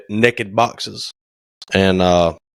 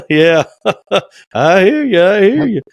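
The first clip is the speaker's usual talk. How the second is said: higher and louder with an awkward chuckle; nervous laugh; 10 semitones higher, 4 dB louder than usual. Retold or imitated. The builder caught out, mock nervous laughing reply. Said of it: "that old nervous laugh".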